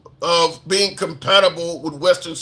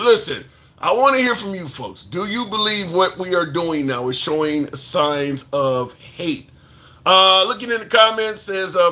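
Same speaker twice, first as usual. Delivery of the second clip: usual speaking voice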